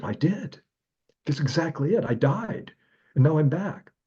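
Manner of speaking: elated, voice lifted high; excited declaration. Retold or imitated. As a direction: announce it like a miracle. Retelling return from death.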